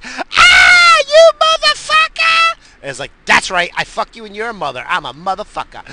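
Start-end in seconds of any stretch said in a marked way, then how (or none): 0.0-2.6 shrieking wife impression at full blast; imitated scream. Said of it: "And she screams". 3.3-5.8 loud, raised-pitch boastful comeback; retold defiant shout. Retelling his yelled comeback to wife.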